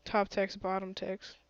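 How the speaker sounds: ordinary talk, nothing marked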